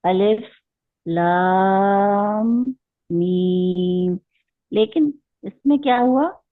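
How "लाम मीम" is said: लाम and मीम drawn out very slowly; Quranic recitation. Shown as a demonstration. Teacher reciting the separated letters slowly. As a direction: chant लाम, मीम with long held vowels. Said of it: "six count खींचेंगे".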